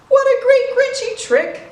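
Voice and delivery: high, loud gleeful cackle of a voice; gleeful Grinch. Acted. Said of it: "he chuckled and chuckled".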